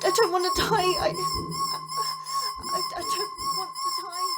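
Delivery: crying, broken, pleading not to die; sobbing in terror. Acted. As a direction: beg through sobs, blinded and terrified. Blinded, begging, crying.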